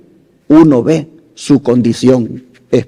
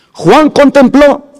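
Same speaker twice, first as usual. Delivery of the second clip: higher and slow, preaching emphasis on contempló; raised emphatic repeat; 10 semitones higher, slower than usual. Shown as a demonstration. Preacher repeating to his congregation for impact, raised and emphatic. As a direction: lift the repeated phrase into a proclamation. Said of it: "Juan contempló (repeated)".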